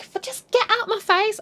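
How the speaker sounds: high, strained acted snap; mock exasperated outburst. Daughter argues with mother on the stairs, mock exasperation. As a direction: imitate a fed-up daughter. Acted quarrel before the push.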